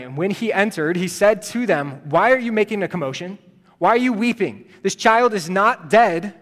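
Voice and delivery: Jesus confronting the mourners, loud and firm; rebuking. Acted. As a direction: challenge the wailing crowd.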